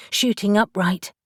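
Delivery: ordinary delivery, nothing marked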